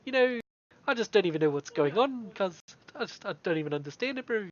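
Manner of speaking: faux-idiot New Zealand bro accent; hammed-up New Zealand street accent; New Zealand fob accent. Shown as a demonstration. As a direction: do a dopey Kiwi fob accent. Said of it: "accent in New Zealand".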